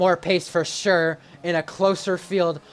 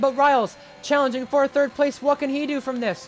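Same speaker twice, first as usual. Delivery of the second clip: higher, excited race call; motorsport commentator; 9 semitones higher than usual. Real, not acted. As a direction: call the battle live, building energy. Live race play-by-play.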